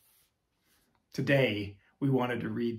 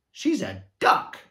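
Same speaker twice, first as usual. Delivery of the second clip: higher, louder call from the crowd; crying out; 8 semitones higher, 8 dB louder than usual. Acted. Party guest calling out about Petunia, loud cry. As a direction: blurt it across the room. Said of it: "came a cry".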